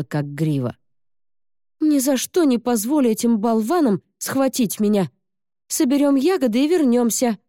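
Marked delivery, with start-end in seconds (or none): 1.8-7.4 put-on determined girl. Girl's determined vow.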